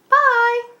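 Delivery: high, loud sing-song bye; cheery goodbye. Real, not acted. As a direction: wave the viewers off brightly. Signing off the video.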